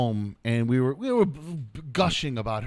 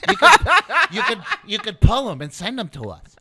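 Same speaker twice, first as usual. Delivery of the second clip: laughing; 6 semitones higher, 10 dB louder than usual. Real, not acted. Laughing urging viewers.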